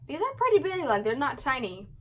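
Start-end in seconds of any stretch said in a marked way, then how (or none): 0.1-0.8 amazed. Real, not acted. Marvelling at snack size.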